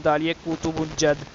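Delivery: ordinary talk, nothing marked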